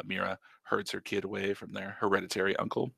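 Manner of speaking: usual speaking voice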